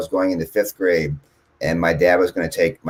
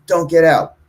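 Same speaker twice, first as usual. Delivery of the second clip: raised, higher mock yell of mom; mock scream; 8 semitones higher, 5 dB louder than usual. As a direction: imitate mom yelling a warning. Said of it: "my mom was just screaming at us".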